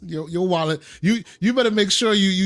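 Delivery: ordinary talk, nothing marked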